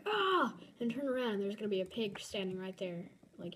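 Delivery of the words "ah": mock scream, high and loud; short acted shriek; imagined startled yelp. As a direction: act out a sudden fright. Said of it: "I'm gonna, like, be like".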